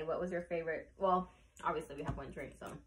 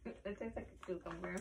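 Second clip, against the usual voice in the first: eating chips while speaking; talking while chewing; 10 dB quieter than usual. Real, not acted. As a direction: mouth partly full of food. Seen 0: Bites chip at -2s, chip held near mouth while talking.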